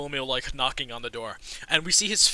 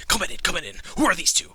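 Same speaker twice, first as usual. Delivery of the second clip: brusque welcome; 4 semitones higher, 6 dB louder, much faster than usual. Acted. Gatekeeper waves guests in, eyes strangers.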